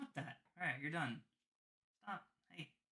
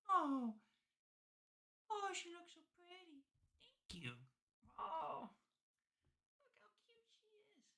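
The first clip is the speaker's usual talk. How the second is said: high cooing; 14 semitones higher, 5 dB quieter than usual. Real, not acted. Owner praising her sitting dog, high cooing voice. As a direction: coo it up high like to a puppy.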